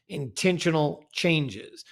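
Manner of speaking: usual speaking voice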